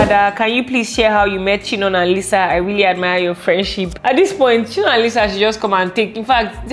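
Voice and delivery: ordinary talk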